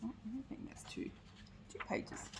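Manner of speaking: whispered aside to herself; a quick whispered note off the story. Real, not acted. Reader's aside about pages.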